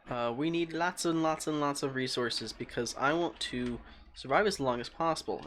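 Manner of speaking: ordinary delivery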